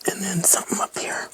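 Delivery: whispering. Real, not acted. Musing to herself while crafting.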